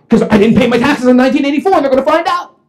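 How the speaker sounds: fast, high, loud blurting; panicked confession. Retold or imitated. Calvin explaining his emergency call, panicked rush. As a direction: blurt it out in hysterics.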